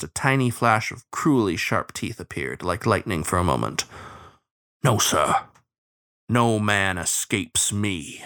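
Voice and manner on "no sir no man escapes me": slow, grave bloodhound vow; solemn, menacing hound lawman; sepulchral. A narrator's reading. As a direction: state it with cold finality. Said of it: "his sepulchral voice".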